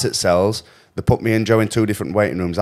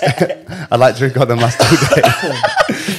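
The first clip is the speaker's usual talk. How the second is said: higher-pitched, talking through laughter; laughing; 5 semitones higher, 5 dB louder than usual. Real, not acted. Friend jokes about filming the trip, laughing as he talks. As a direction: laugh your way through the words. Joking about the last two days.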